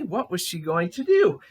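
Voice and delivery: plain reading voice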